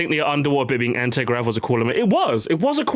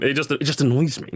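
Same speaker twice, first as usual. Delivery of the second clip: ordinary talk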